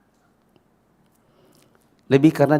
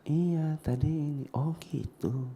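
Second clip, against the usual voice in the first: soft, hushed exchange; following in lowered voice; 4 semitones higher, 9 dB quieter, much slower than usual. Retold or imitated. Boy and adult chatting quietly after he lowered voice, soft. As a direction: keep it very quiet and gentle. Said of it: "dia pun otomatis ngikut".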